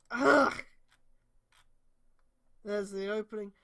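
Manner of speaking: ordinary talk, nothing marked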